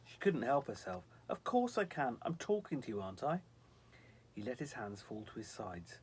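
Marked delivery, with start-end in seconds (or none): 1.3-3.4 high, lively angel voice; a bright snow angel answering the girl. Acted. Snow angel protesting to Sylvie.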